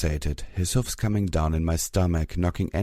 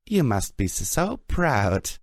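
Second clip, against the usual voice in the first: high, slow timid quip; nervous quip; 6 semitones higher, slower than usual. A narrator's reading. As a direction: squeak it out meekly.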